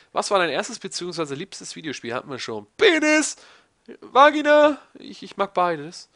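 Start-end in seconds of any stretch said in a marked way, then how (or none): 2.8-4.8 high, loud silly yell; silly shout. Pretend. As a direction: blurt it out childishly. Blurting silly words.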